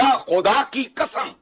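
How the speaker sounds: high, slow, heated oath; exasperated oath. Real, not acted. Guest swearing during a tirade at the anchor, exasperated.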